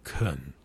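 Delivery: "kun" low and soft with schwa; "kun" said low, soft and reduced; weak kun syllable. Shown as a demonstration. Teacher shows the weak first syllable kun. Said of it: "go on to the next consonant sound".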